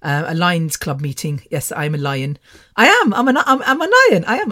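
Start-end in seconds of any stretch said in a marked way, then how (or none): 2.8-4.5 high, loud, laughing protest; giggly, high-pitched self-mocking boast; amused insistence. Real, not acted. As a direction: insist through giggles, voice shooting up. Insists playfully, laughing, that she's a lion.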